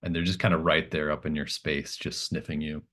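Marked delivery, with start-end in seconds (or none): none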